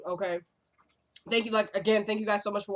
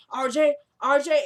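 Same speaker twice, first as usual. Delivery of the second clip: calling out; 4 semitones higher, 6 dB louder than usual. Retold or imitated. Father calls to crying baby.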